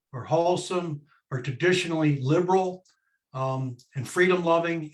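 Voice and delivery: normal talking voice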